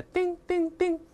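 high, ringing imitation of clinks; crisp dinging. Shown as a demonstration. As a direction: separate little bell-like dings. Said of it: "发出清脆的叮叮".

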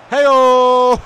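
loud, high jokey call; teasing whoop. Acted. Streamer ribbing a Scouse viewer, teasing whoop. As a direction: whoop it out like a jeer.

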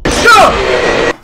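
sudden high yelp; startled yelp. Real, not acted. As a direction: caught off guard yelp. Jumpscare shout.

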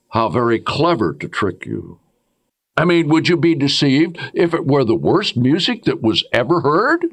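normal talking voice

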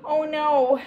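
a touch of a wail; understated wail. A narrator's reading. Girls seeing the dog slip away, mildly wailed. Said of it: "wailed, all three girls".

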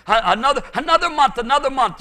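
high, loud insistent repetition; urgent preaching. Real, not acted. Preacher warning there may not be another year, urgent preaching. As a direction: press it on them urgently.

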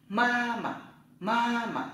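ma mà said high, the second syllable rising; Chinese tone drill. Shown as a demonstration. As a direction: model ma mà slowly for learners. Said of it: "Tập lại".

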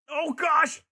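real high, loud startled yelp; startled cry. Real, not acted. Player reacting to a sudden mishap, startled cry.

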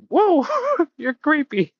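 very high, slow creature voice; laughing impression of a startled little gremlin; Gizmo. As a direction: squeak it, breaking into laughter. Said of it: "Gizmo stood back like".

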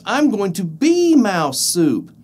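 high, slow frightened mouse; scared mouse voice. Acted. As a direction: wail it like a doomed mouse.

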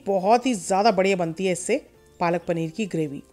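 usual speaking voice

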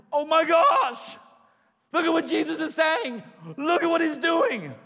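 high excited gush over Jesus; amazed crowd. Retold or imitated. Townspeople marveling at Jesus, high and excited. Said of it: "It started with".

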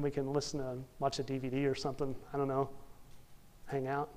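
low, soft, unenthusiastic brush-off; acted half-hearted excuse. People putting off time with God, acted half-hearted and low. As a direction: mumble it reluctantly, as if wriggling out of plans.

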